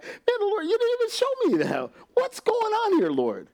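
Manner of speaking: high incredulous protest; mock astonished plea. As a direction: squeak it out in disbelief. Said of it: "I'm like".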